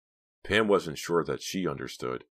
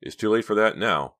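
loud, raised-pitch self-reproach; scolding herself; 6 semitones higher, 7 dB louder than usual. A narrator's reading. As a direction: snap yourself out of panic. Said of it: "She scolded herself".

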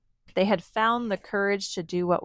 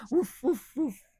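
high playful woofs; showing the kids how to bark; dog barks; 7 semitones higher than usual. Shown as a demonstration. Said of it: "Can you guys bark for me".

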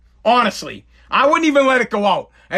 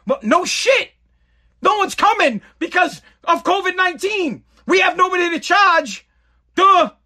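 higher, sneering voice of the fans; the nation mocking the team's press release; sarcastic public; 8 semitones higher than usual. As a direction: roll your eyes at the obvious. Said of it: "the whole United States is gonna look at us and say".

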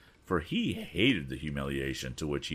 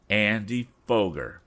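cried out; 5 semitones higher, 5 dB louder than usual. A narrator's reading. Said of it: "cried the young inventor".

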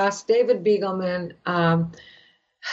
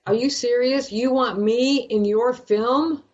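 slow, higher-pitched naive disbelief; imitated dim question, drawn out and pitched up; mock stupidity; 4 semitones higher, slower than usual. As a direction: play your clueless younger self, incredulous. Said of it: "stupidly, of course, being blonde and Polish".

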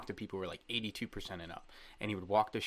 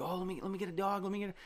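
much higher, silly mock-eager voice; mocking eager people; 11 semitones higher, faster than usual. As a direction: parody careless people begging for a dog. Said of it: "these people like".

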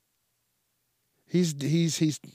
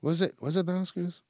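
ordinary talk

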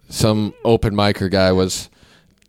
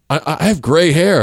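indignant whine; 8 semitones higher than usual. Retold or imitated. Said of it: "the guy was like".

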